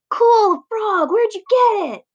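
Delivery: put-on high, loud, eager kid voice; excited kid. Classmate gushing over the frog in class, high and excited. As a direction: burst out, thrilled by the frog.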